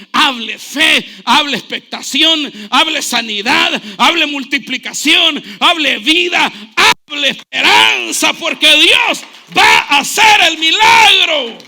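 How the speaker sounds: loud, rising exhortation; full-force preaching. Acted. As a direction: drive each command home to the congregation.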